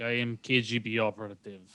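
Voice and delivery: lightly voiced Russian accent; a hint of a Russian agent. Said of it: "she puts on the accent".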